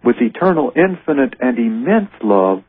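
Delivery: lifted, unhurried reading of a holy text; slow prayerful reading over music; slow devotional reading. Acted. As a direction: draw out each phrase like a prayer. Reading Jesus' words slowly.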